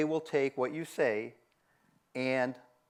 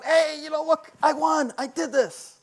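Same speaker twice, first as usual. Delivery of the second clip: much higher and louder, bursting with a win; happy, excited; 12 semitones higher, 8 dB louder than usual. Retold or imitated. Coworker boasting a win, high and elated. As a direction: crow about winning like a thrilled kid. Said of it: "If somebody's happy, they're going".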